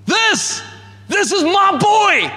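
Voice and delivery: high, ringing proclamation of pride; God bursting with pride over his Son; joyful proud father. Pretend. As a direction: announce the son with bursting joy. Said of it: "this is the way that God speaks".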